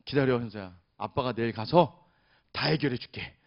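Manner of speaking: calm the crying daughter, low and tender; soothing a child. Retold or imitated. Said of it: "제가 아이를 달래주고".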